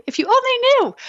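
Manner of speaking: high, loud sarcastic squeal; mock squeal. Mom answering judgy ladies, high loud squeal. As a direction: fling it up high in exasperation. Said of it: "I was like".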